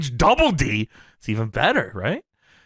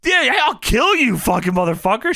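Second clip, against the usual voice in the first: high, loud hammed-up threat; mock furious threat; 8 semitones higher, 3 dB louder than usual. Lauren threatening the wrestler, hammed-up high yell. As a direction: yell it like a cartoon rage. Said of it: "Lauren says".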